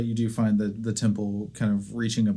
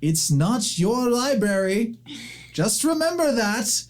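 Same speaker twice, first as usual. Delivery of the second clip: put-on old woman, sharp reminder; 13 semitones higher, slower than usual.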